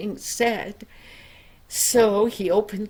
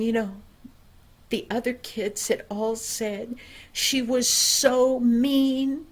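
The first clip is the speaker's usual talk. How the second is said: plain reading voice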